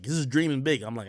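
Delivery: ordinary talk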